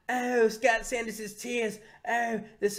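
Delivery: high, slow, savouring cartoon voice; Cartman. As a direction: relish every word in mock ecstasy. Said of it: "And he's like".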